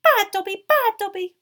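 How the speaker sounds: very high, loud elf squeal; frantic squealing house-elf. A narrator's reading. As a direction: squeal it in self-punishment.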